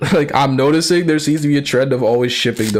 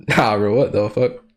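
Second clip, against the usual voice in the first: ordinary talk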